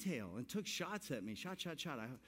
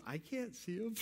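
laughing wife's line; 7 semitones higher than usual. Retold or imitated. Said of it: "She goes".

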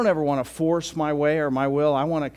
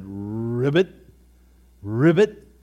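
lightly voiced ribbit, second one louder; frog sound; 2 semitones lower, 6 dB louder, much slower than usual. Grandpa doing a mild frog croak for the little girl. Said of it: "as best he could".

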